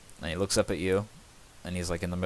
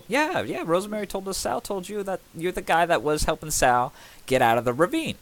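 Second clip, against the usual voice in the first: higher, rushing eager babble; eager gushing; 11 semitones higher than usual. Acted. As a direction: gush the gossip like a fan. Smith gushes the town gossip.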